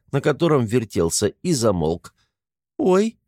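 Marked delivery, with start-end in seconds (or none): 2.8-3.2 высокий короткий вскрик испуга; пискнуть от неожиданности; испуганный писк. A narrator's reading. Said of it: "пискнула Луша". (испуганно пискнуть)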